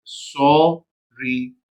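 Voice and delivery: very slow, drawn-out sorry; slow blended word. Shown as a demonstration. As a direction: stretch sorry out to show the sounds. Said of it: "कैसी आवाज निकलती है इसकी". (धीरे खींचकर sorry)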